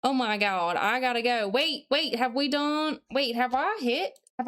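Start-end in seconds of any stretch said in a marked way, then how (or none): none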